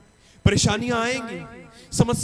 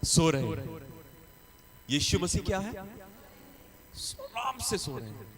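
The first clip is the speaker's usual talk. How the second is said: lower, softer, slow and peaceful; calm and soft; 6 semitones lower, 11 dB quieter than usual. Shown as a demonstration. Preacher describing Jesus asleep in the storm, calm and soft. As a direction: let it sink into calm like sleep. Jesus calmly sleeping contrast.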